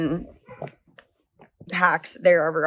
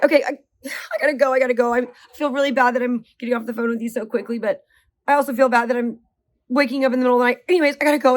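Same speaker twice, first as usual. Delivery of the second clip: higher and louder, hurrying off the phone; rushed apology; 5 semitones higher, 3 dB louder, faster than usual. Retold or imitated. Wife hurrying off a late-night call with friend's mom, flustered apology. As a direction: apologize while already hanging up.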